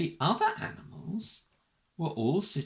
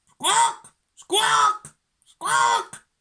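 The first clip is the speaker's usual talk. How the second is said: very high, loud screeched squawks; parrot squawking; 9 dB louder, much slower than usual. A narrator's reading. Parrot joins the waiting-room din, loud shrieking squawks. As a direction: screech each word like a parrot. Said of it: "parrot began to squawk".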